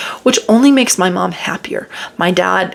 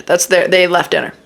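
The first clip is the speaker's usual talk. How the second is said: ordinary delivery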